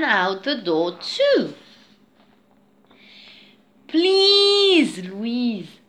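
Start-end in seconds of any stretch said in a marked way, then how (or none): 3.9-5.8 drawn-out, loud, high "please" falling away; pleading. A narrator's reading. Jake begging his sister to let him read, pleading. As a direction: beg with a long first word. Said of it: "pleaded Jake".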